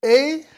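high, loud, held "A" as ay; mid vowel A. Shown as a demonstration. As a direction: sound out "A" emphatically on its own. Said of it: "a mid vowel".

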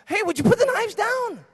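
high, loud parental scolding; exasperated yell. Retold or imitated. Father to son at the restaurant table, exasperated yell. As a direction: act out a dad losing patience loudly.

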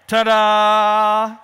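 loud fanfare reveal; showman ta-da. Acted. Showman unveiling the stunt to the audience, loud fanfare. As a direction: present it like a magic trick.

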